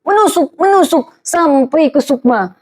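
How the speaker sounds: MENUSUK high and falling, repeated; piercing emphasis. Shown as a demonstration. Preacher illustrating words that pierce, high stabbing MENUSUK. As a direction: jab each MENUSUK high like a stab.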